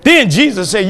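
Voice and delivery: ordinary talk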